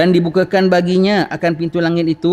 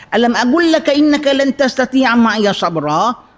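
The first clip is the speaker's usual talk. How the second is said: harsh rebuke; 7 semitones higher than usual. Retold or imitated. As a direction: snap it at Musa sternly. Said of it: "Takkanlah Nabi Khidir garang gitu". (Khidir menengking Musa dengan garang)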